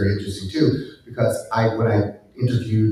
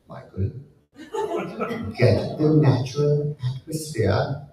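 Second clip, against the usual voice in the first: put-on terrible British accent; 5 semitones higher than usual. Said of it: "God, I sound terrible like that".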